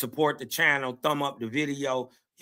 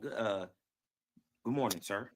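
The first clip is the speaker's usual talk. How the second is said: mock polite to boss; 7 semitones lower, 9 dB quieter than usual. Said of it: "He'd be like".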